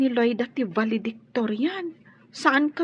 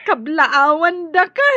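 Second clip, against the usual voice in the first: louder, slower cry of joy; tearful congratulations; 3 semitones higher, 8 dB louder than usual. Acted. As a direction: congratulate him through happy tears. Mother congratulates son, crying. (naragsak a sangit a panagkablaaw)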